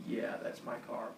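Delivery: quiet, low-energy confession; resigned admission. Retold or imitated. Driver admits to the cops that it's his car, quiet resignation. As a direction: own up under your breath. Giving in and owning up.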